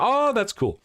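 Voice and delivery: high-pitched admiring "oh"; imagined impressed reaction. Retold or imitated. Host voicing viewers' future reaction, high mock amazement. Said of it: "You're, you're gonna be".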